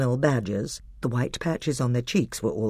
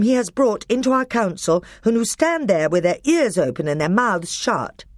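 higher, louder demand; higher stern king; commanding young king; 9 semitones higher, 5 dB louder than usual. A narrator's reading. Caspian demanding.

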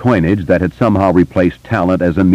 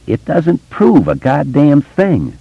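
higher, slow and dug in; stubborn; 10 semitones higher, slower than usual. A narrator's reading. Eddie insists stubbornly to his old friends. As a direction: refuse to budge on each word. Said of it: "Eddie repeated stubbornly".

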